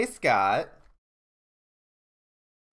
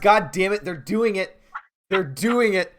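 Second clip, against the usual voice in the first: excited; 8 semitones higher, 9 dB louder than usual. Real, not acted. Reacts to creepy nursery rhymes.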